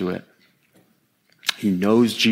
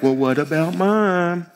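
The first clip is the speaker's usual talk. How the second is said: higher, put-on little brother voice; whiny little brother; 6 semitones higher than usual. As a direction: protest like a kid sibling. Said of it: "Sounds like a little brother, like".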